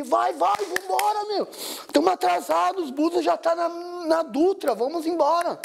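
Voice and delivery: high-pitched frantic urging; acted panic to get the taxi moving; urgent hurrying. Acted rushing the stunned driver.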